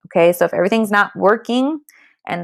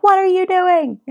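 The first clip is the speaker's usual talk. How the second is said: high, louder mock voice of someone baffled; imitating a baffled onlooker; 10 semitones higher, 3 dB louder than usual. Mocking what others will say to a mother who hesitates, high and loud. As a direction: squeak it out like a confused outsider. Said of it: "They'll be like".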